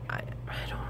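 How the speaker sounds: breathless broken whisper. Real, not acted. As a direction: voice drops to a bare whisper. Breaks down mid-sentence.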